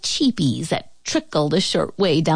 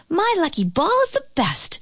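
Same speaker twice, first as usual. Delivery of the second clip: put-on high, bright child's voice, gently calling out; a girl bragging about her ball, pitched higher; proud little girl; 12 semitones higher than usual. A narrator's reading. Annie boasting as she hurries past, high-voiced. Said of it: "she shouted".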